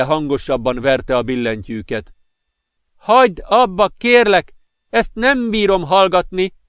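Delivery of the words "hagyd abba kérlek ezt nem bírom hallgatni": higher, louder pained begging; anguished plea. A narrator's reading. Girlfriend begging him to stop playing, anguished plea. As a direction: cry out for the pounding to stop. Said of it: "kérte a szerelme fájdalmas arccal".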